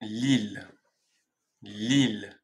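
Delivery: LILLE said alone as "lil"; pronunciation demo. Shown as a demonstration. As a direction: LILLE with a sounded l, falling pitch. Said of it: "On dit donc Lille".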